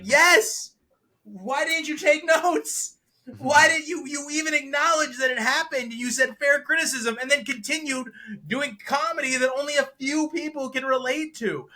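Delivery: high, loud, exasperated outburst; exasperated retort. Acted. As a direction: fire back at the comedian in disbelief. Acted retort to the comedian.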